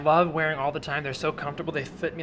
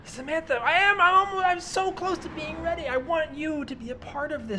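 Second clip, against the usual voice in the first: high, strained, pleading voice; desperate pleading; 12 semitones higher than usual. Acted. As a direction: beg her to stay, pitch cracking up high. Begging girlfriend not to leave.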